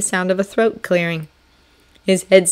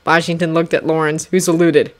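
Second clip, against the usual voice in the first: usual speaking voice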